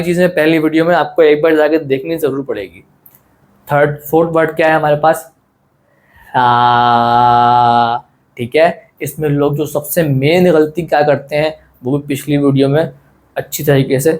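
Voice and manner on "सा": सा with the vowel held long on a level note; stretched letter. Shown as a demonstration. Teacher reads the fourth letter, सा held long and level. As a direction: draw सा out in a sustained monotone. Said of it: "आपको पढ़ के बताऊँगा".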